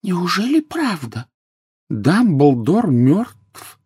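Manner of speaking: very high, very slow female voice; high, halting shocked question; stricken disbelief. A narrator's reading. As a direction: drag out each word in dismay. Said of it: "прошептала полная дама".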